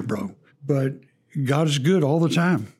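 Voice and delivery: ordinary delivery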